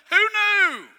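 laugh, then a high, loud mock cry of who knew; hammed-up laughing punchline; laughing mock eulogy. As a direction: burst out laughing at the absurd eulogy. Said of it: "imagine me doing that in a funeral service".

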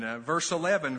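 ordinary talk

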